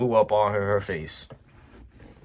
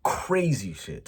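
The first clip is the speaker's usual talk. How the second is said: usual speaking voice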